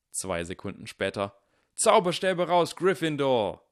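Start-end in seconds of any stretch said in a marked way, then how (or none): 1.7-3.6 high, very loud battle cry; rallying shout. A narrator's reading. Gryffindors join the stand-off, shouting. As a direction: shout your house to arms.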